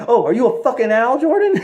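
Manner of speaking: high, mocking jab, breaking into a laugh; mock angry wife. Wife mocking her husband, high laughing sneer. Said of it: "she's all like".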